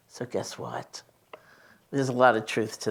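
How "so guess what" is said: whispered aside, breathy and hushed. Retold or imitated. Retelling Bob's subway image to an audience, whispered. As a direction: drop to a secret whisper for the punchline.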